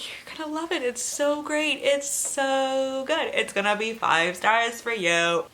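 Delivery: high-pitched, hammed-up gushing praise; mock gushing fans. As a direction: parody the fans who hyped the book. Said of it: "And they're like".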